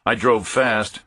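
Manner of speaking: plain reading voice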